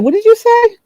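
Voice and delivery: high, startled "What did you say?"; confused woman asking a stranger to repeat himself, high; puzzled question. Retold or imitated. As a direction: ask again, thrown.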